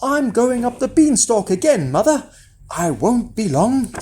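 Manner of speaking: put-on boy telling mother his plan; young Jack. A narrator's reading. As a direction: announce it as an eager boy.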